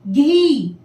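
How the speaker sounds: घी with a stretched long ii; drawn-out long ii. Shown as a demonstration. Teacher modelling घी for children. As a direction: draw out the vowel of घी. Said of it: "घ में".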